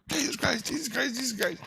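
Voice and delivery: high, desperate call to Jesus. Retold or imitated. Stressed bystander calling Jesus, high and pleading. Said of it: "I call Jesus from my heart".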